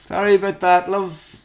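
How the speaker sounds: high-pitched, distracted apology; mock apologetic viewer. Diner spilling gravy during Columbo, mock apologetic. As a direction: apologise while glued to the screen.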